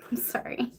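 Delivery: giggly whispered sorry; sheepish whispered giggle; whispered laughing apology. Real, not acted.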